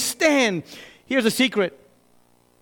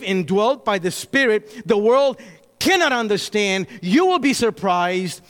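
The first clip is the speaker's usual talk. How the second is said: normal talking voice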